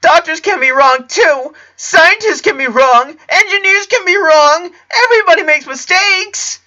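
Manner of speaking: very high, loud desperate cries; frantic screamed denial; panicked screaming. A narrator's reading. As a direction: scream it, close to tears. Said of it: "he screamed".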